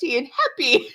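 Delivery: high, fast, strained mock cheer; upset but forcing brightness; forced happy. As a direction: consort forcing cheer at the meeting. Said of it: "be, like, all happy".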